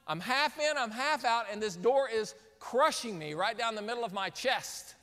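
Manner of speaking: ordinary talk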